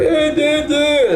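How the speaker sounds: high, dopey "duh" sounds; mock dumb grunt. Mocking the dumped simp, a high dopey "duh". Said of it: "you're like".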